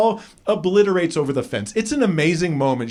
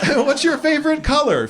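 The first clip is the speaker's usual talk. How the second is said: playing the interviewer, lightly teasing; high mock interviewer; 10 semitones higher than usual. Comic asking the athlete, voicing the interviewer, high and amused. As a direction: question pitched high and bright, with a laugh. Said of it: "mock interviewer".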